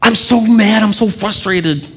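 imitating a frustrated believer; frustrated new believer. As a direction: voice her frustration with a lifted pitch. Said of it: "this person says".

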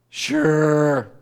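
a bored, grudging sure with a sigh; dismissive sigh. Retold or imitated. God's people answering him with a put-upon sigh. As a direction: sigh it off like a sulky kid. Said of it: "we're his people going".

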